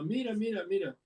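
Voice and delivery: put-on high, eager child's voice calling mira; lightly voiced little kid pointing something out. Kids in next car alerting mom. (niño emocionado avisando a su mamá, voz aguda)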